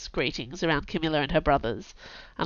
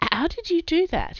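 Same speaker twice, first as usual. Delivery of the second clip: high, insistent question; demanding; 11 semitones higher than usual. Acted. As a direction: press him for an answer. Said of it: "he demanded".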